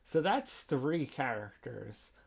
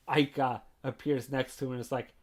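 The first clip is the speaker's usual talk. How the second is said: usual speaking voice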